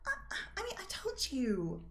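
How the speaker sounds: high, halting game character; Rosalind, hesitant confiding. Acted.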